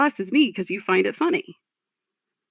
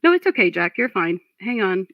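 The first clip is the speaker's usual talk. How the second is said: ordinary delivery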